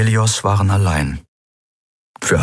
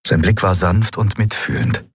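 ordinary delivery, nothing marked

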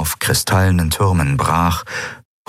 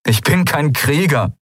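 high-pitched, agitated denial; worked-up admission of weakness; helpless protest; 9 semitones higher than usual. Acted. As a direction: plead helplessness, pitch well up. Helpless man protests to eidolon.

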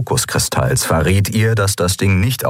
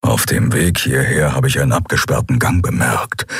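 low calm Keru; 5 semitones lower than usual. A narrator's reading. Keru voiced lower.

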